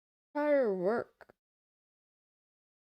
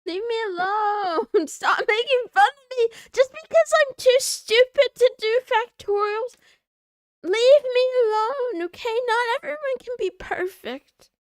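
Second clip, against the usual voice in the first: loud, higher, half-laughing fake tantrum; mock outburst; 8 semitones higher, 11 dB louder than usual. To chat mocking her maths, loud mock-tearful outburst. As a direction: yell back at the teasing chat, hammed up.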